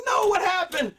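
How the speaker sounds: stunned fan. Retold or imitated. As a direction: blurt it out in shock as the show cuts. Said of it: "I'm like".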